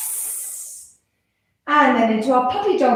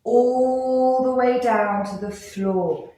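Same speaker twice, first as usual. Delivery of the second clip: slow drawn-out; slower than usual. Shown as a demonstration. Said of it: "sprinkle our fingers".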